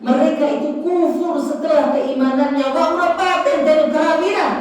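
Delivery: high-pitched, pressing sermon voice; impassioned preaching. Acted. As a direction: drive the point home from the pulpit. Preacher at raised voice.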